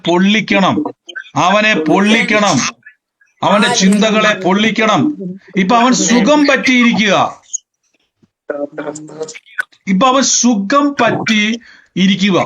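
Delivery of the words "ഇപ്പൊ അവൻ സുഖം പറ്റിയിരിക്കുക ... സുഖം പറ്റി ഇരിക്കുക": raised preaching. Acted. Preacher mocks the devil at ease.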